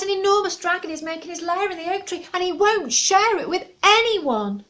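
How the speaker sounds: high, fast, upset squirrel; panicked squirrel. Acted. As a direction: blurt out the news in alarm. Squirrel reports dragon.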